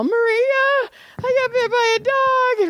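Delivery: very high, drawn-up cry for sympathy; acted-out wailing. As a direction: re-enact wailing the news, high-pitched. Acts out distressed call to coworker.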